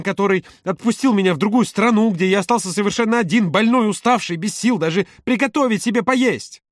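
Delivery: high-pitched, mounting desperation; impassioned tirade. A narrator's reading. Володя begging Юра to imagine his place, impassioned. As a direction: build from pleading to a high outcry.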